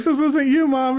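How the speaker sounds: high, loud mom voice; lightly voiced mom character; shrill nagging mom. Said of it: "the voice I use for".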